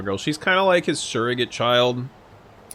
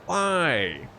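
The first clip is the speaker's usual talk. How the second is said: ordinary talk, nothing marked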